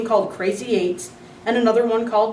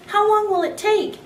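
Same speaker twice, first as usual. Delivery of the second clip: put-on much higher, whiny child; 14 semitones higher, 4 dB louder than usual. A narrator's reading. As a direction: whine it like a bored kid. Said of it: "asked in a whiny voice".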